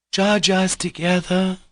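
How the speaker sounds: high, slow, desperate plea; frantic cry. A narrator's reading. Grushenka to the officials, pleading frantically. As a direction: beg to share his fate. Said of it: "Grushenka cried frantically".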